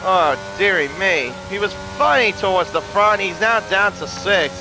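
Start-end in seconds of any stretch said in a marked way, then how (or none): none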